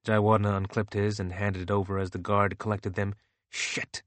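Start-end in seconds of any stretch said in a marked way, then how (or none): 3.5-4.0 under-the-breath swear, almost unvoiced; muttered curse. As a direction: hiss it out in annoyance. Muttered curse at a dropped badge.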